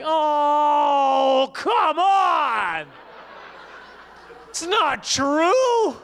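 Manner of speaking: high, drawn-out incredulous cry. Acted. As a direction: stretch it out in comic outrage. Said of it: "And I was like".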